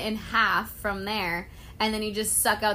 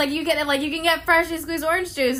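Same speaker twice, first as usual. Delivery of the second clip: high, loud gushing quote; gushing excitement; 5 semitones higher, 5 dB louder than usual. Retold or imitated. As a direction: rave about it like a tourist. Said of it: "I'm like".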